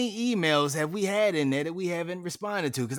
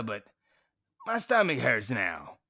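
normal talking voice

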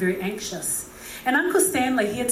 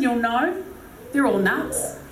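ordinary talk, nothing marked

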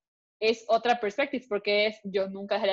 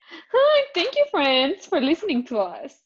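much higher, louder cheery greeting; bright sign-off; 7 semitones higher, 4 dB louder, slower than usual. Real, not acted. Podcast host thanking listeners in a high, loud, cheery voice.

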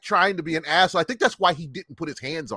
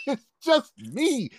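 high, drawn-out mocking repeat; incredulous echo; 9 semitones higher, 3 dB quieter, slower than usual. Angry father repeating the boy's excuse, incredulous. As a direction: throw the words back slowly in disbelief. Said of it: "he got angrier".

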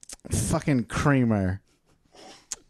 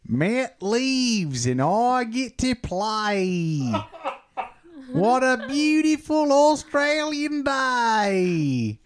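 broad mock Aussie voice, higher pitch; Australian accent; 10 semitones higher than usual. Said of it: "Voiced by Johnny Australia".

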